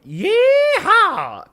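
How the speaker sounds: high, very loud cowboy holler; Texan yeehaw. Acted. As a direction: let out a hammed-up Texan whoop. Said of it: "Maybe a good".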